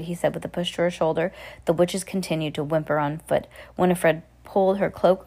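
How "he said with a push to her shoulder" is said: usual speaking voice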